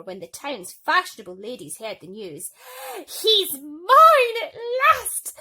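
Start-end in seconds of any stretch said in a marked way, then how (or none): 3.2-5.3 very high, loud, slow triumphant squeal; gleeful cry. Acted. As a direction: crow with delight. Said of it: "cried Bertha".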